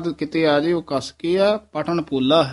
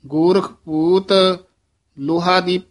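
ordinary talk, nothing marked

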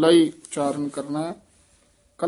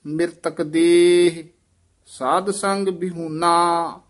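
slow measured chanting of Gurbani; scripture recitation; 2 semitones higher, 4 dB louder, much slower than usual. Acted. Teacher reciting the hymn for students to repeat, measured chant. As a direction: recite each phrase with reverent pauses.

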